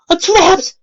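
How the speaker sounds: high, loud panicked cry; terrified of being trapped. A narrator's reading. Pony panics in blizzard.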